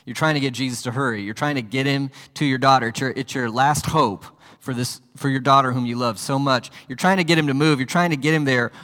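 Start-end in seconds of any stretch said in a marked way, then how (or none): none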